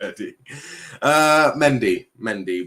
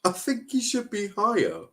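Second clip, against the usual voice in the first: high-pitched, stubborn protest; high and disbelieving; incredulous insistence; 12 semitones higher than usual. Real, not acted. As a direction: insist on it, voice pushed way up. Insists against co-host's objection.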